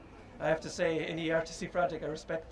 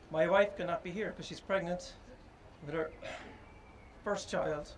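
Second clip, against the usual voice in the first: choked up; 7 dB quieter than usual. Real, not acted. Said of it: "I'm choking up a little bit".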